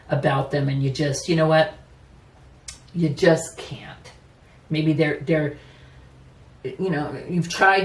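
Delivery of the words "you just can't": slow hushed whisper. Acted. To viewers, about a partner you can't stand: slow whisper. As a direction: breathe it out, slow and defeated.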